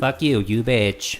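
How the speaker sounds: slow cursing robot. Pretend. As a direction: stiff robot insult. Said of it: "You make that robot voice fairly well".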